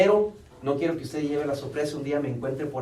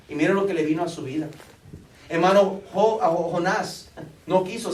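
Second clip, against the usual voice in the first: loud, high, urgent preaching from the pulpit; fervent sermon climax; full-force preaching; 5 semitones higher, 7 dB louder than usual. Acted. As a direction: preach it out to the congregation, pressing hard.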